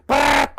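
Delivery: loud rolled prrrt; loud rolled-r gun burst; gunfire trill. Retold or imitated. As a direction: trill a quick burst of fire. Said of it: "gunfire imitation".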